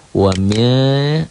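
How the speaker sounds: "Wa min" high, ending in a held nasal hum; ikhfa. Shown as a demonstration. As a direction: stretch the hidden n in "Wa min". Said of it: "karena nun mati ketemu shin". ("Wa min" didengungkan samar)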